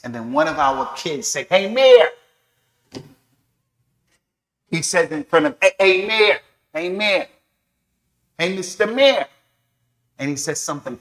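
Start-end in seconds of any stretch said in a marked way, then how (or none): 1.5-9.3 high, loud repeated call to the mayor; kid calling out. Acted. A cheeky kid interrupting the adults, shouting at the mayor. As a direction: yell hey mayor across the hall.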